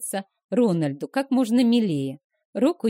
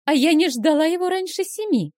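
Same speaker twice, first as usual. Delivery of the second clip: exclaiming; 8 semitones higher, 3 dB louder than usual. Acted. Vilma's delighted exclamation continues.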